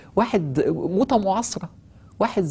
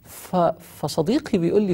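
ordinary delivery

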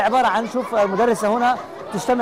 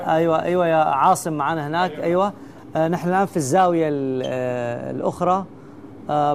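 low, steady on-air report; TV presenter; 3 semitones lower, 3 dB quieter than usual. Acted. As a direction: read it like a live broadcast. Mock live TV broadcast.

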